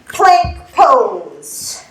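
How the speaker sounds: PLANK and POSE drawn out slowly; unhurried pose cue. Shown as a demonstration. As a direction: stretch out both words, PLANK and POSE. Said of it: "gonna do it a little faster".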